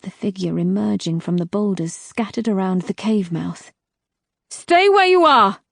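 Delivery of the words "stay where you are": high, loud shouted challenge; shouted warning. Acted. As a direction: bark it at the stranger in the dark. Challenging a stranger at cave.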